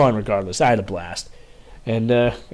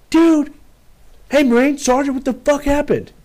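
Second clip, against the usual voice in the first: much higher, louder mock bark; imitating first sergeant; 14 semitones higher, 4 dB louder than usual. First sergeant demanding answers at formation, high mock yell. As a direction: ham up the angry first sergeant.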